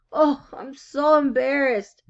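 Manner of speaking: a mother wringing her hands; embarrassed mother. Acted. Mother frets about sock hole.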